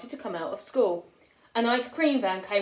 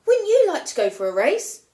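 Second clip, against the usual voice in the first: cheery coaxing question; 8 semitones higher, 6 dB louder than usual. Acted. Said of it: "they asked Dave".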